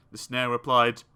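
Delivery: ordinary delivery, nothing marked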